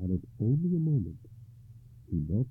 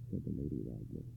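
ordinary delivery, nothing marked

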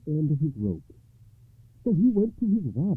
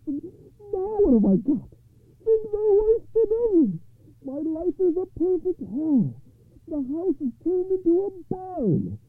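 very high wailing poor man; frantic high-voiced plea for help; crying out in distress; 3 dB louder than usual. A narrator's reading. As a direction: bewail it loudly in a high-pitched voice. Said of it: "returned to the rabbi and cried".

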